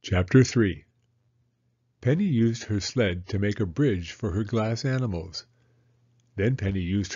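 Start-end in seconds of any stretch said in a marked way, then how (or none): none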